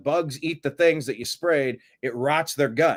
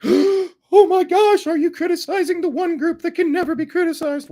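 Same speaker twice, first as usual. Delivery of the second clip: mock offended voice; 6 dB louder than usual. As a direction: sneer it in a squeaky offended voice. Mocking an offended critic.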